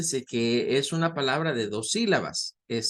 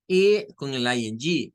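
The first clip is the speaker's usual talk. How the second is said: usual speaking voice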